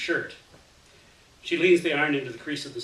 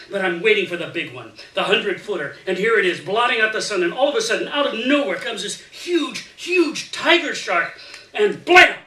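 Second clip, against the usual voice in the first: louder and higher toward the attack, ending in a shout on blam; building excitement; 6 semitones higher, 11 dB louder than usual. Acted. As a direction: build it up like a campfire yarn hitting the scary part. Storyteller builds to climax.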